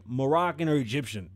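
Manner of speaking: ordinary talk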